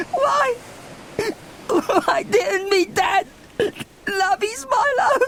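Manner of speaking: high, wailing sob for a lost dad; sobbing. Acted. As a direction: cry it out, voice breaking. Milo sobbing for his dad.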